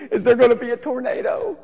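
high-pitched, imitating a wailing kid; mock hysterical crying. Frightened child asking mom about a tornado, mock hysterical crying. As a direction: whine it high like a panicked child. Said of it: "hysterical crying".

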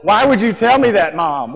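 put-on loud, high-pitched kid's complaint; mock outraged protest. Scared child protesting mom's answer, loud mock outrage. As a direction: cry out in exaggerated disbelief at mom.

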